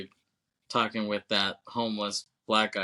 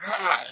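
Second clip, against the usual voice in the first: kick yell; 10 semitones higher, 3 dB louder than usual. Retold or imitated. Thugs kick him in face.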